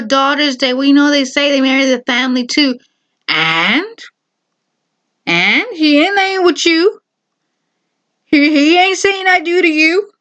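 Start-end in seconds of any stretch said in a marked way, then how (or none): 3.3-10.1 higher, emphatic sass; sassy. Real, not acted. Vlogger scolding the pushy mother, higher sass. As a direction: put her in her place, higher.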